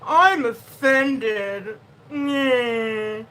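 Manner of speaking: very slow, prissy mock sulk; mock offended. Mimicking easily offended people, very slow prissy sulk. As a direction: sniff it out like a sulky prude. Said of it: "walk around like this all day".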